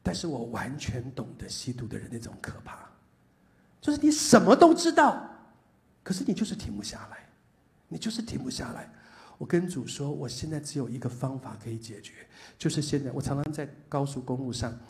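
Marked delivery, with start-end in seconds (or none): 3.8-8.9 1 anguished outcry. Real, not acted. Pastor describing addiction's grip.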